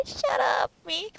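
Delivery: high, teary scolding of herself; real tears breaking through the words; crying reaction, telling herself to stop; tearful self-scolding. Real, not acted. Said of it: "I'm saying shut up to me".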